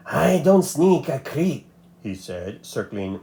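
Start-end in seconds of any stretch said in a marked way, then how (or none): 0.1-1.7 slow, sly lizard voice; sly lizard suitor. A narrator's reading. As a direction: creep the words out slowly like a smug lizard.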